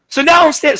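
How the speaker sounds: high, loud storytelling burst; telling it too loud, carried away; excited and too loud for the mic. Real, not acted. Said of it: "sorry, I'm too loud".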